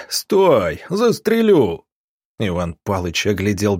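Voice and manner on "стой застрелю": high, far-carrying menacing shout; shouted threat. A narrator's reading. Armed pursuer to fugitives in the boat ahead, shouted threat. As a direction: holler the threat across the water. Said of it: "А потом прокатилось".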